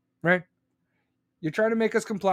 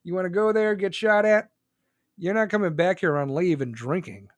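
ordinary delivery

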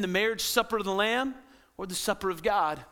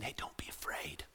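soft whispered reassurance; comforting whisper; 8 semitones lower, 14 dB quieter than usual. Acted. Jesus calming a frightened listener, soft whisper. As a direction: whisper it to calm fear.

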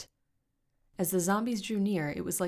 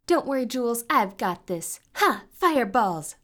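loud, confident battle cry; boast, then hurl the spell name; shouting; 7 semitones higher, 11 dB louder than usual. A narrator's reading. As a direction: bold shout with high bursts. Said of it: "shouted Zoe".

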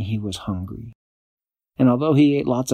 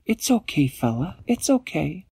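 soothing; 8 semitones higher than usual. A narrator's reading. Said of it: "he is really scared".

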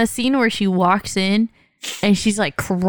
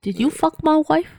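mock movie line; 6 semitones higher than usual. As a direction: put on a higher, hammed-up movie voice. Movie quote thrown in as a joke.

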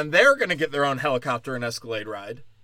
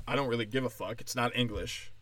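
usual speaking voice

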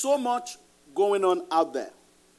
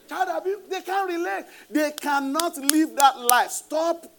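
much higher, building exclamations; excited high preaching; 11 semitones higher, 6 dB louder than usual. Real, not acted. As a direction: rise up and press the point.

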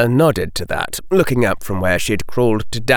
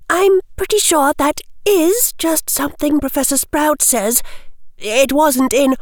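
high schoolgirl picking her way through; careful. Acted. Hermione correcting the Hufflepuff story, careful. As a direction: explain carefully in a high girl's voice. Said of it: "Hermione said carefully".

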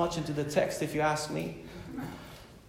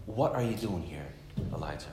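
soft, low and tender question; quiet, gentle divine question; gentle voice of God; 10 semitones lower, 11 dB quieter than usual. Retold or imitated. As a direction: ask it gently, lowering the voice. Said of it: "gentle voice, in a, in a whisper".

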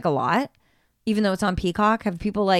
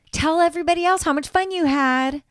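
bossy Dorinda; 8 semitones higher than usual. Retold or imitated. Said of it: "she goes".

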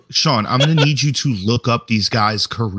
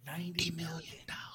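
very soft whisper; whispered awe; 5 semitones higher, 22 dB quieter than usual. Real, not acted. Co-host mulling a rumoured golf payout, awed whisper. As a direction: whisper the sum to yourself.

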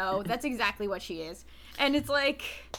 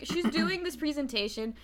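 usual speaking voice

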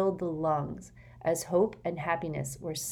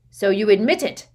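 triumphant shout; loud accusation; 4 semitones higher, 11 dB louder than usual. Acted. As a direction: pounce on the admission, loud.

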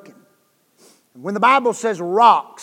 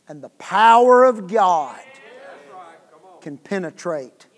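normal talking voice

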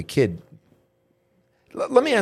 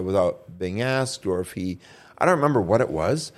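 ordinary delivery

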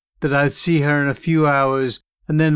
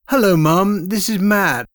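put-on higher, flustered young man's voice; stammering; 6 semitones higher than usual. A narrator's reading. Son on phone to mum in prison, flustered stammer. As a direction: stammer it out, caught off guard. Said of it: "I stammered".

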